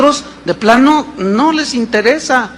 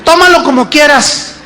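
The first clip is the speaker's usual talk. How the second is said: a high, indignant wife's retort; wife answering her husband, proud and offended; 8 semitones higher than usual. Acted. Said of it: "la otra también bien orgullosa".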